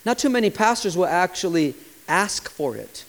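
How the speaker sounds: ordinary talk, nothing marked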